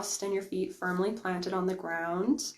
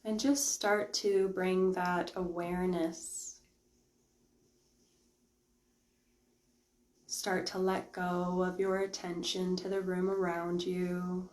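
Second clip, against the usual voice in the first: slow meditation voice with long pauses; guided meditation; 4 dB quieter than usual. Real, not acted. Meditation host guiding live viewers to let go of the room, slow guided-meditation voice. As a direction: ease them into stillness, unhurried and quiet.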